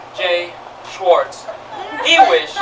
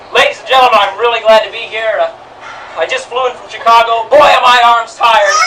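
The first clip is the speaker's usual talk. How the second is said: loud punchy club-comic delivery, rising to a shout on the punchline; stand-up comic; 4 semitones higher, 6 dB louder than usual. Retold or imitated. Rabbi replays the comic's opening night joke, hammy and loud. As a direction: sell the old joke to the club crowd.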